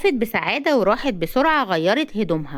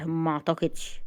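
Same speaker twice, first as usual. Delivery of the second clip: soft, low and resigned; sad; 4 semitones lower, 7 dB quieter than usual. A narrator's reading. Amr doubting he will ever marry, quietly sad. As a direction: drop to a quiet, sad murmur. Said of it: "اتنهد بحزن وهو بيحاول يرسم".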